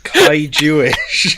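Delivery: loud, crying with laughter; laughing repeat. Real, not acted. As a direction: squeal it through laughter. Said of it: "Rob, don't say that".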